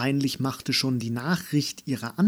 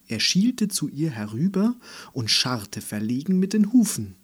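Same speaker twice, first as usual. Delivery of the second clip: plain reading voice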